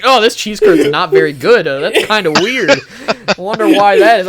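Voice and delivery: a lightly voiced mock puzzled reaction; mock puzzled taster. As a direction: play the friend chewing along, only faintly confused. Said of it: "hoping you just eat it and be like".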